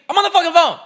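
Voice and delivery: fed-up yell at the drive-thru worker; angry yell. Retold or imitated. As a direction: snap at her in full frustration. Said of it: "I just wanna be like".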